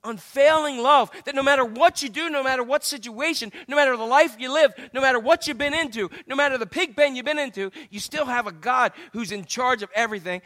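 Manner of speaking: usual speaking voice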